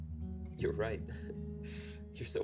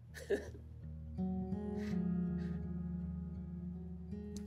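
unhinged laugh; 6 semitones higher, 3 dB quieter than usual. Acted.

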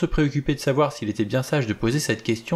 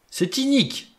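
much higher, strangled cry of outrage; choking protest. Acted. Vera protests the accusation, choking with outrage. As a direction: choke out the protest. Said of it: "s'étrangla Vera".

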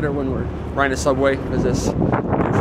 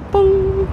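sung chime note; 4 dB louder than usual. Retold or imitated. Imitating turnstile chime.

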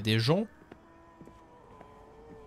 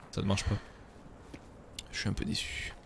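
soft whisper, mock let down; whispered disappointment; 3 semitones lower, 9 dB quieter than usual. Streamer finding the doors shut, a mock-disappointed whisper. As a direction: whisper it like a sulky aside.

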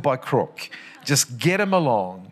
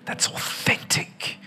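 soft whisper; 5 dB quieter, slower than usual. Acted. Pastor voicing church's welcome.

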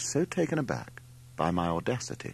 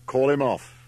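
louder, higher urgent order; commanding; 5 semitones higher, 6 dB louder than usual. A narrator's reading. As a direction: command it loudly, pitch raised. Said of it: "Larry commanded".

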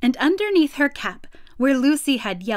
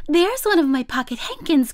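put-on higher, eager little-girl exclamation; excited cry; 5 semitones higher, 4 dB louder than usual. A narrator's reading. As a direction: exclaim on spotting your lost things. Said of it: "cried Lucy".

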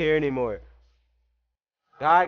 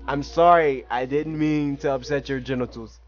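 ordinary talk

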